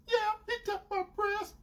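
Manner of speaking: high, soft mimicked admission; sheepish girlfriend. Girlfriend confirming what he heard, imitated meekly.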